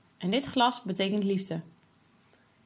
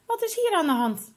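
surprised mother; 11 semitones higher, 4 dB louder than usual. Acted. Mother finds children fighting.

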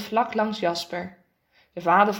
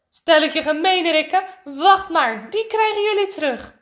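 loud, higher playful outrage; raised voice, pretend scolding; mock-indignant threat; 6 semitones higher, 5 dB louder than usual. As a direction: threaten the boys with playful revenge. Drenched fathers threaten playful revenge.

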